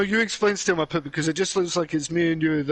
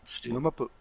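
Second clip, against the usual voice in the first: understated backup rap echo, second time softer; backup rapper; 2 semitones lower, 4 dB quieter than usual. Shown as a demonstration. Co-host shows his backup line, backup rapper. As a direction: toss in a low-key hype man's ad-lib. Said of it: "like, backup, backup shit right there".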